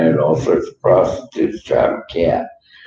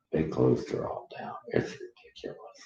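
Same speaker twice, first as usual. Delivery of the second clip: whispered, much softer, voice fading; hushed, fed-up aside about the tracks; disgusted whisper; 3 semitones lower, 10 dB quieter than usual. Real, not acted. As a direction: mutter it under your breath in disgust. Disgusted complaint about closed tracks.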